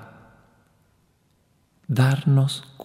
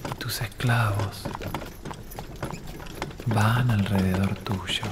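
guided visualization; 9 dB quieter than usual. Acted. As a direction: lead the listener gently into the imagined scene. Leads guided imagination exercise.

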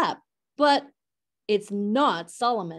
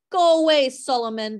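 lightly squawked storks, high and loud at the start; mild squawk; 5 semitones higher, 4 dB louder, faster than usual. A narrator's reading. Storks shooing Solomon off with a mild squawk. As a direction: call out like cross storks, falling away. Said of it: "the storks squawk".

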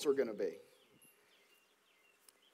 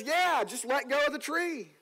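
higher, offhand voice of God from above; lightly voiced God telling him to let go; casual God voice; 5 semitones higher than usual. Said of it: "And the voice says".

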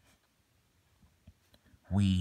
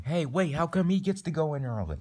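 higher-pitched, put-out complaint; indignant protest; 8 semitones higher, 3 dB louder than usual. Acted. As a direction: protest the unfairness, pitch jumping up. Acted protest at queue jumping.

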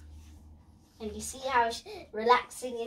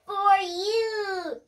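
put-on beaming child; 8 semitones higher, 4 dB louder than usual. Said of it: "he beamed".

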